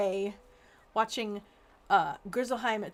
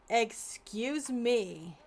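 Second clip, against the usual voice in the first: slow, drawn-out, louder indignation; mock offended; 5 dB louder, much slower than usual. Gamer mock-offended by the NPC, drawn-out indignation. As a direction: act outraged in slow motion.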